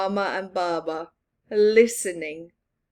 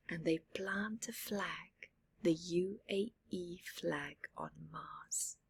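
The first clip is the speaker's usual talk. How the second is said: soft whispered narration; 15 dB quieter than usual. A narrator's reading. Narrator whispering flag planting on Mars, hushed. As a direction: hush it like a secret.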